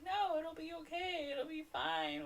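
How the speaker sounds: soft, high-pitched soothing; mock reassurance. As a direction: brush the worry away lightly, imitating them. Everyone acted reassuring panicked Lucy.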